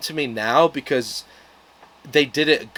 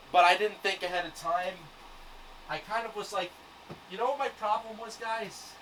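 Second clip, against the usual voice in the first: speaking from across the room, softer and higher; calling back while off fetching books; distant voice off-mic; away from the mic; 5 semitones higher, 9 dB quieter than usual. Real, not acted.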